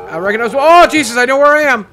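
loud, high outburst of dread; alarmed recognition. Real, not acted. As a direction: burst out as it dawns on you. Scary game, recognises the place.

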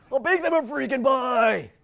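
loud, slow, hammed-up Jason Street accent; mock Jason Street accent. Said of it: "you can hear that in the Jason Street accent".

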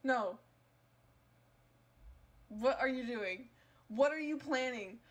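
ordinary talk, nothing marked

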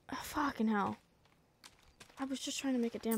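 under the breath, whispered swear; whispered curse. Real, not acted.

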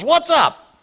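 high-pitched, gruff imitated accent; Smith Wigglesworth's accent. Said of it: "He goes".

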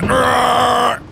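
high, held "arrgh"; frustrated yell. Real, not acted. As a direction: let out a high howl of frustration. Angry at losing the frame.

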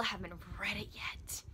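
whispered little confession; guilty whisper. Real, not acted. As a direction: hush it like a guilty secret. Confessing unread anticipated book.

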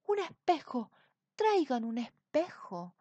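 panicking king calls for mirror; frantic demand. A narrator's reading.

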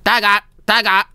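quick, high imitated video game attack shout; high, fast Street Fighter style call; fighter's kick cry. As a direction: yelp it like a kicking fighter. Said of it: "kicks really hard and goes".

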